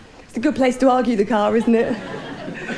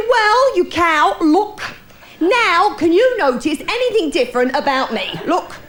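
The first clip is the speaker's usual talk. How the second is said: higher, mock-indignant husband, showing off; 5 semitones higher, slower than usual. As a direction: play the proud husband, pitched up.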